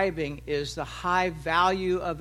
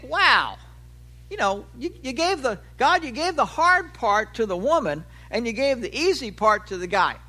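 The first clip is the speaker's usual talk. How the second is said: mock indignant complaint; 5 semitones higher, 4 dB louder than usual. Husband imagines complaining to God.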